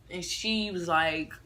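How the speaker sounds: ordinary delivery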